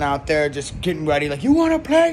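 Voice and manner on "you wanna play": high, loud hammed-up Scarface taunt; yelled mock movie gangster challenge; mock Tony Montana. Comic telling his Scarface remake, as Tony taunting, high and loud. Tony voiced in comedy retelling.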